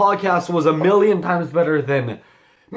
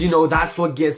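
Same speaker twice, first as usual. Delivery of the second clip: normal talking voice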